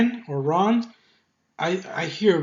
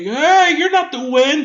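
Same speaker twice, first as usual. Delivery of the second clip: guard's startled shout; 10 semitones higher, 8 dB louder than usual. Retold or imitated. Dumb guard spots Jin late.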